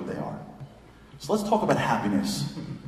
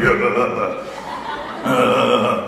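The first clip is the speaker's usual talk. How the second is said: manly grunting; 4 dB louder, slower than usual. Retold or imitated. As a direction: grunt like two guys watching sports. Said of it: "we're grunting at each other".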